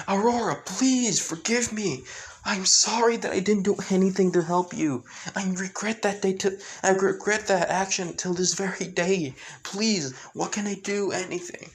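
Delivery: high, desperate plea; frightened pleading. Acted. The frightened woman begs Aurora for forgiveness, high desperate plea. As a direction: beg for forgiveness in terror. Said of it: "extremely frightened, with her yelling".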